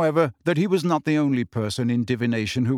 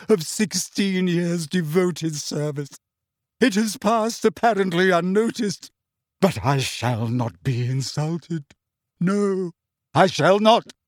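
choked=61; 5 semitones higher, slower than usual. A narrator's reading. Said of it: "she choked".

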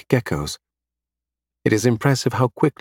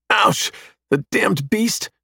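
high-pitched, pained outburst; angry scream; 10 semitones higher, much slower than usual. A narrator's reading. Adam is attacked by a small beast, screaming angrily. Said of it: "Adam screams angrily".

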